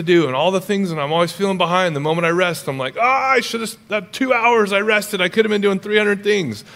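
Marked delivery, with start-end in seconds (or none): 3.0-6.6 high-pitched fretting over lost time; mock frustration. Preacher voicing his guilt after resting two hours, high frustrated fretting. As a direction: fret aloud, exasperated with yourself.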